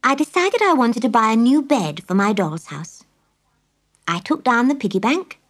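ordinary delivery

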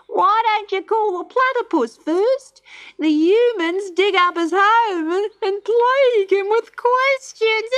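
very high, squawking bird voice; cackling kookaburra, teasing. A narrator's reading.

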